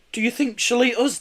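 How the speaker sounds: high, nervous question; nervous child. Acted. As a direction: ask nervously. Kenny afraid of Nessie.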